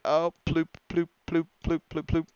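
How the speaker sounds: high, cartoonish bird squeak; silly bird voice. Shocked bird dropping its own load, high cartoon voice. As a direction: pop each ploop high like a cartoon bird. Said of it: "the bird was like".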